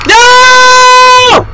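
a sudden piercing high scream of alarm; real scream. Real, not acted. Streamer grabbed by the monster, real terrified scream. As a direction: shriek as it catches you.

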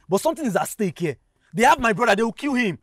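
high, urgent pleading; desperate plea. Acted. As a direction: plead as if a life depends on it, pitch climbing. Pleads for kidnapped brother's life.

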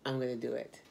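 quiet self-pep talk. Retold or imitated. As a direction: talk yourself into it under your breath. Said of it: "and I said".

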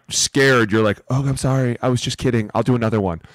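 normal talking voice

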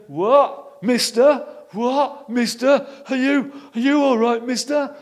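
put-on high, loud children asking "What, mister?"; loud, rising kids' voices; puzzled children. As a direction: shout up like confused little orphans. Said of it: "And all the little ones going".

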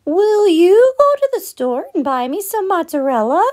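high chirpy hen voice. A narrator's reading. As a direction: squeak it out like a little hen. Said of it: "she said".

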